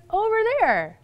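high-pitched imitation of a child. Child points out a friend to mom, high childlike voice. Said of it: "She goes".

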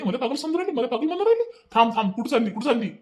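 high-pitched, worked-up complaint; indignant outburst. Acted. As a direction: rant in a rising, outraged voice. पागल कहे जाने पर भड़का पति.

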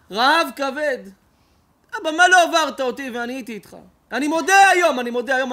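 loud, high anguished prayer; loud anguished confession to God; impassioned cry. Acted. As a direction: cry out to the father in heaven. Rabbi's cry to God, shouted.

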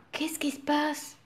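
hushed suspense whisper. A narrator's reading. Storyteller asking the listening children, whispered. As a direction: whisper it as if sharing a secret.

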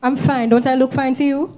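loud, defensive retort; irritated insistence. Acted. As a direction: insist loudly that nothing is wrong. Snapping at worried choir mate.

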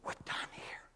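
soft unvoiced whisper of God. God quietly telling the pastor to leave, whispered. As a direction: breathe it out without voice. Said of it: "God was trying to whisper to me".

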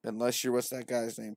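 ordinary delivery

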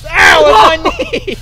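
loud, high yelp of real pain; pain cry. Real, not acted. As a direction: cry out sharply from a knee hit. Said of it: "Hurts like a bitch".

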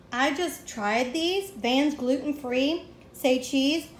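ordinary talk, nothing marked

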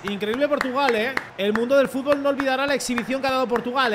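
loud, high mock congratulations; hammed-up ironic applause; sarcastic praise. Real, not acted. Sarcastic praise for beaten Portugal. (felicitación sarcástica y exagerada)

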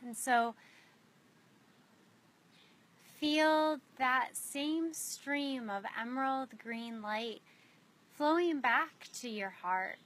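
unhurried meditation guide with long pauses; spacious, measured guiding voice, letting each image settle; guided meditation. Real, not acted. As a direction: lead them gently inward, phrase by phrase.

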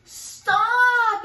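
big loud booming stop; gorilla's yell. A narrator's reading. Gorilla yelling at the fleeing mouse. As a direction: bellow it to halt her. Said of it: "yelled the gorilla".

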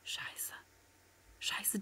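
hushed unvoiced swearing; whispered curse. Real, not acted. Startled player whispering a curse. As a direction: curse under your breath in panic. Enemy spotted.

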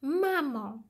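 high, loud call for mum; boy shouting. A narrator's reading. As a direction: call out like an excited child arriving home. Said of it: "krzyknął Wojtek".

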